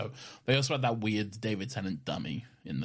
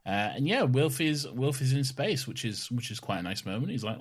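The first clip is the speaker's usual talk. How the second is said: ordinary talk, nothing marked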